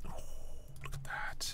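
a soft whisper full of wonder; amazed whisper. Real, not acted. To viewers, amazed by the push-button arrow, in a soft whisper. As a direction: hush it like you can't believe it.

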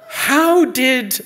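loud, high mother's outburst; outraged shout. Acted. Ma finding Killer in the dress, outraged shout. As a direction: demand it at full voice.

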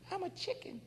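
high breathy timid bird; squeaky whispered bird. Retold or imitated. The bird whispering back its refusal. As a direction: shrink away, whispering.

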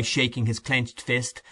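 plain reading voice

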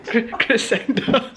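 giggling through the word; laughing. Real, not acted. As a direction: laugh while saying it.

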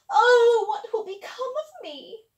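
high wailing cry of dismay; wailing stepmother. Acted. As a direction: wail it like a spoiled stepmother. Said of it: "said the stepmother".

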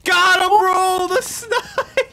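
high stretched triumphant yell; excited yell. Real, not acted. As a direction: yell out the big kill. Big kill, yelling.

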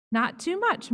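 put-on high little girl plea; child pleading. A narrator's reading. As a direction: beg like a small girl. Girl at haircut.

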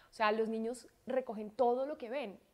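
ordinary delivery

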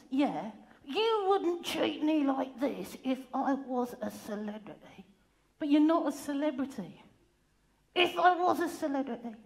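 ordinary talk

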